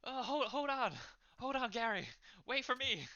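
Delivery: high, loud plea to wait; high-pitched hurried character voice; anxious friend calling. Acted. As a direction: call after a friend who is leaving. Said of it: "his friend Jim is just like".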